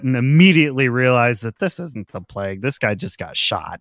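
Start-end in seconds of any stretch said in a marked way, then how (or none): 1.6-3.8 low, quiet, offhand grumble; dismissive aside. Acted. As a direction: brush it off under your breath, much lower. Game master voices dismissive realization.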